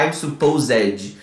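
"supposed" with -ed as its own syllable, suh-POH-zedgy; slow mispronounced "supposed", adding a Brazilian "edgy" ending; wrong -ed ending. Shown as a demonstration. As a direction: sound out the -ed in "supposed". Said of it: "A gente não fala".

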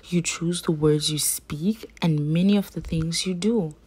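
plain reading voice

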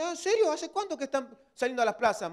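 high-pitched incredulous questioning; mock surprise. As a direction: imitate a church member who had no idea. Mimics a clueless church member. (sorpresa fingida, con voz aguda)

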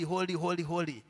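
ordinary delivery

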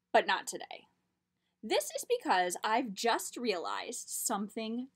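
lively storybook read-aloud; reading to children. A narrator's reading. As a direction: tell it like a picture book. Reads picture book aloud.

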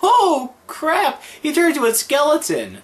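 high startled cry; shocked yelp. Real, not acted. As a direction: yelp in sudden surprise. Sudden shock in game.

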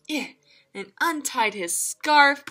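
usual speaking voice

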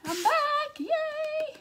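real excitement at being back live; excited yay. Real, not acted. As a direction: cheer it like a happy homecoming. Cheering her return to the stream.

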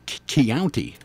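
"county" echoed as "kee-ownty"; Southern vowel. Retold or imitated. Echoing the Georgia accent just demonstrated, "county" as "kee-ownty". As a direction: try out "county" with an ee glide after a stuttered k. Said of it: "I said county".

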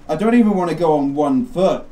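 normal talking voice

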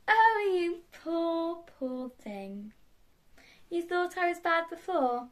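high, drawn-out villainous taunt; mock pity. Villain taunting the beaten hero, mock pity. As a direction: coo with false sympathy, then taunt.

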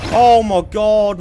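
slow, loud drawn-out amazement; can't believe what just happened; stunned disbelief. Real, not acted. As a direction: stretch it out in shock. Stunned after clutch win.

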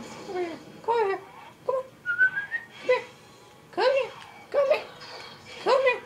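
high, whiny come here; pleading whine. Real, not acted. Player begging his monster to come over.

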